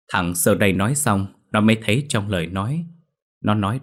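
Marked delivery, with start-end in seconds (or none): none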